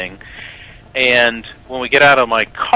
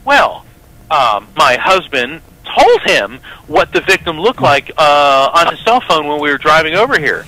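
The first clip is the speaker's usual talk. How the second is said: high, louder, overly sweet cover story; secretary smoothing things over with detectives, sweetly high; secretary stepping in; 5 semitones higher, 4 dB louder than usual. Retold or imitated. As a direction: play it innocent and bright, stepping in. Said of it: "She goes".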